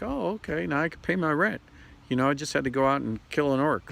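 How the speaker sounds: high, chirpy mock delight; mock elated gamer. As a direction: lightly play a thrilled gamer. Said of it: "and then you're like".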